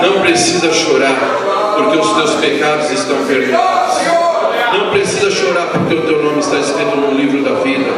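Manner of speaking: low, reassuring words to the weeping; imitated consoling. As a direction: drop low and comfort them as Esdras. Voices Esdras consoling the weepers.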